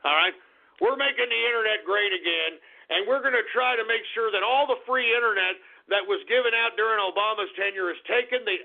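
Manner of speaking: normal talking voice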